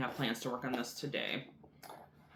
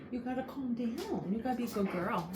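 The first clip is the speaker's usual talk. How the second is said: higher sing-song voice for a dog; high pet talk; 9 semitones higher than usual. Real, not acted. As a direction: coax the dog, pitched up. Soothing the excited dog.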